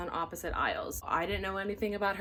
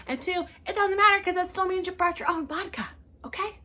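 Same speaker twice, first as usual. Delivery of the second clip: exasperated callout; 9 semitones higher, 4 dB louder than usual. Real, not acted. Calling out the passenger.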